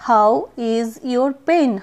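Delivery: How is your pain said very slowly; slow classroom model; slow demo. Shown as a demonstration. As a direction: lay each word out for the learner. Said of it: "তো বলবো".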